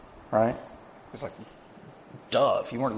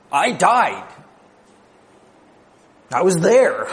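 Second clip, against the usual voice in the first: louder and higher, proclaiming; emphatic declaration; 5 semitones higher, 4 dB louder than usual. Acted. Risen Jesus insisting to John, loud emphatic declaration. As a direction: hammer home each word as testimony.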